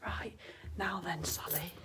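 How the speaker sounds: whispering. Real, not acted. Hushed aside to herself.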